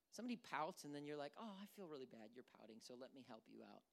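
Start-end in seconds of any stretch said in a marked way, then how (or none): 1.4-3.8 soft, low fake pity; mock sympathy. Adult answering someone who pouts, softly mock-sympathetic. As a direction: murmur sympathy you don't mean.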